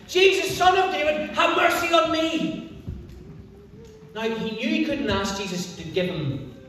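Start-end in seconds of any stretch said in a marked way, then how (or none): 0.1-2.8 raised high, calling out desperately; shouting plea. Acted. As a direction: cry out to be heard by someone passing by. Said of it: "then he starts to shout".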